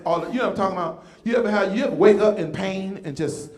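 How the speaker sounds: ordinary talk, nothing marked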